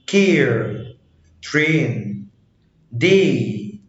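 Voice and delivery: "كير", "ترين", "داي" with one long ay vowel. Shown as a demonstration. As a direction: stretch the vowel in ترين like an Arabic ye. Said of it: "مرة تانية".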